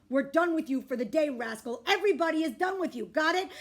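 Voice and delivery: loud firm scolding; stern dad. A narrator's reading. Dad to rascal child, loud stern scolding. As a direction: lay down the law.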